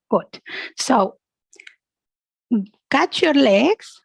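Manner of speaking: usual speaking voice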